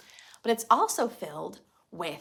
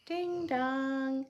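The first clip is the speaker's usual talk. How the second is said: sung two-note doorbell, high; doorbell chime; 6 semitones higher than usual. Acted. Story reader to children, singing the doorbell. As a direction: chime it like a bell.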